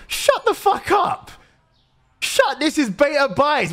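high-pitched, indignant yell; outraged shout. Pretend. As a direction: shout down a complaining customer. Snapping at a complaining customer.

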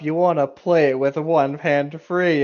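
ordinary delivery

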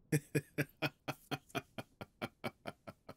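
high staccato villain chuckle; gloating laugh that tapers away; mocking evil laugh. Demon laughing at the possessed human, mocking evil laugh.

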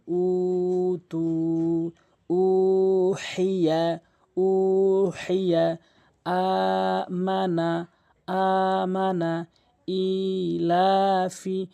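slow recitation, holding the first vowel of "উ হেইয়া"; drawn-out long vowels. Shown as a demonstration. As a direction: stretch the long vowel in "উ তু", "আমানা", "ইলাফি" on a level tone. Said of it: "এখানে কেন টেনেছি?". (টেনে পড়া, "ইলাফি"-র দীর্ঘ স্বর সমান সুরে ধরে রাখা)

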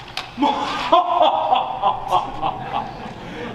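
breathy gloating villain chuckle; smug evil villain laugh. Acted. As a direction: cackle smugly like a scheming villain. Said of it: "practice my smug, evil villain laugh".

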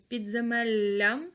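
slow "Підземеллям" with a long double Л; slow dictation. Shown as a demonstration. Teacher stresses the double Л in "Підземеллям". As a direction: hold the ЛЛ in "Підземеллям". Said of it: "дві букви Л".

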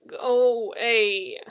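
slow, drawn-out moan; AI tells Stacy to leave, slow and moaning. A narrator's reading. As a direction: groan it like a sulking sick AI. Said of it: "Gina moaned".

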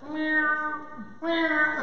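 throaty imitation of a posh cat; posh drawn-out mews; cat meow. Shown as a demonstration. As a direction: meow twice from the back of the throat. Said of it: "It's all at the back of the throat".

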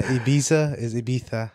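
first Ibiza with s, second Ibiza with th; Spanish lisp. Shown as a demonstration. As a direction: Ibiza plain, then Ibiza as Ibitha. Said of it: "they have a lisp".

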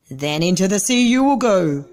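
wild dog snapping a threat at the turtle; snapped. Acted. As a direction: threaten sharply like a cross dog. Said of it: "snapped the Wild Dog".

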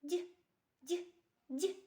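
Д as a short, palatalised sound; soft Д repeated alone, with pauses between; isolated soft consonant. Shown as a demonstration. Teacher isolates the first sound for children, short soft Д. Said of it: "Який перший звук ви чуєте?".

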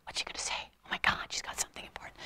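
hushed, fast excited whisper; imitating an audience whispering in suspense. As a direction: whisper like an eager listener. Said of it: "everyone's like".

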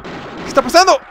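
very high, loud alarm; panicked yell. Real, not acted. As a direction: cry out as the battle falls apart. Boss fight going wrong. (grito de pánico)

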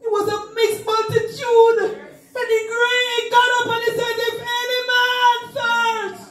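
high, ringing half-sung preaching; full-force preaching. Acted. Preacher urging the church to answer the call, half-sung shouting. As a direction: chant the call out high and strong.

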